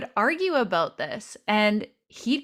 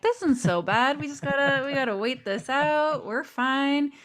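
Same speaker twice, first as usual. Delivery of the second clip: breezy, higher mock-carefree voice; lightly higher, unbothered; carefree self; 6 semitones higher than usual. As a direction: play your old easygoing self. Retelling her own early carefree self.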